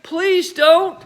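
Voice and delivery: put-on boy praying his grandma stops; anxious prayer. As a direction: beg under his breath. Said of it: "he prayed".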